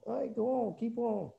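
high, teasing encouragement; egging them on. Retold or imitated. Elijah egging on the prophets, teasing high voice. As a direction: urge them on with mock cheer. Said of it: "he's just egging them on".

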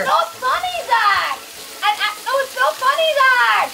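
high furious scolding; angry yelling. Real, not acted. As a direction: yell at him, outraged. Toilet flooded, scolding Zach.

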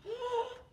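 sharp high gasp of surprise; mock gasp. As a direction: gasp as if startled. Pretend dinosaur comes close.

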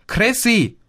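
high, urgent shout after someone; alarmed holler, pitch leaping up; yelling. A narrator's reading. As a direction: yell the name to stop them. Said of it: "brüllte Carlo".

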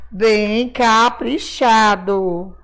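"Bem caprichado" stretched syllable by syllable; slow, drawn-out emphasis. Real, not acted. Teacher asking children for neat writing, slow drawn-out emphasis.

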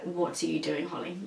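low, hushed self-scolding; muttering at herself. Retold or imitated. Imagining watching herself, muttering in disbelief. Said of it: "I'd be like".

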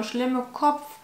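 usual speaking voice

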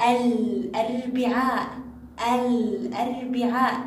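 slow الأربعاء with the L clearly sounded; audible lam. Shown as a demonstration. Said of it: "يخرج صوتها".

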